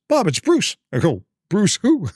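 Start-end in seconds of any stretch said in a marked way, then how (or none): 0.1-0.8 high-pitched caller announcing himself; Bruce Baumgartner. Retold or imitated. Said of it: "And he goes". 1.5-2.1 high-pitched mock puzzlement; puzzled retold reply. Acted puzzled reply on phone.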